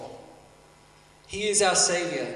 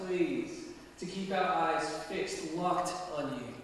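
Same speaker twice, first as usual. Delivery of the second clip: prayer. Real, not acted. I'm gonna pray for us.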